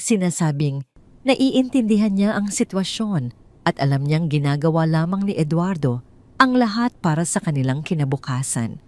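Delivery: ordinary delivery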